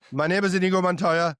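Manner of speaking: a mild hammed-up Inigo Montoya; Inigo Montoya quote. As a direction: recite the line like the avenging swordsman. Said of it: "can you do this all day?".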